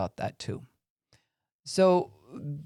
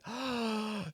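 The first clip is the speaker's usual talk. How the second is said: a sudden high, soft gasp; a quick shocked intake of breath; sharp gasp; 8 semitones higher, 10 dB quieter than usual. Shown as a demonstration. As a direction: suck in air as if startled breathless. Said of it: "like you're taking their breath away".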